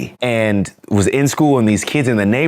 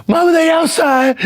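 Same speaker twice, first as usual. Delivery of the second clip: put-on kid yelling. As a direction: burst in shouting for mama. Kid runs home to mama.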